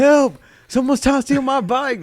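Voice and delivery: high, loud hammed-up yell; teasing imitation of a kid calling for help; mock cry for help. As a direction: playfully scream for rescue, pitch way up. Co-host mockingly acts out cry for help.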